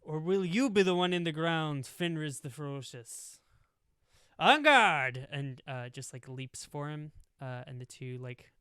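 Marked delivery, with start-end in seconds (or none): 0.0-5.3 higher, louder pirate taunt building to a cry; swashbuckling challenge. Acted. Pirate captain taunts Fenris before the duel, rising to a shout. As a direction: taunt him, then launch the duel with a shout.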